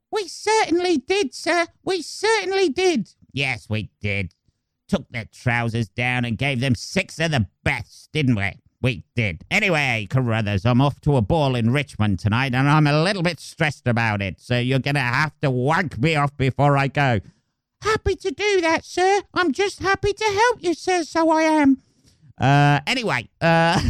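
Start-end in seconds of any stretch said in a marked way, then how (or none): 0.1-3.1 squeaky falsetto yes-man; much higher, eager cockney helper; plucky cockney sidekick. Acted. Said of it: "the plucky fucking cockney sidekick". 3.3-17.2 put-on a plummy old general giving orders; posh general. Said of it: "the posh general". 17.8-21.8 squeaky falsetto yes-man; much higher, eager cockney helper; plucky cockney sidekick. Acted. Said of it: "the plucky fucking cockney sidekick".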